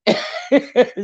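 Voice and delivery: a short, high, real laugh at the memory; burst of laughter. Real, not acted. Recalls laughing hard at kids.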